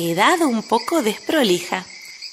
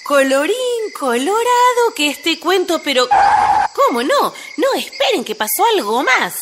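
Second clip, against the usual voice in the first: excited; 8 semitones higher than usual. Acted. Narrator interrupts ending excitedly.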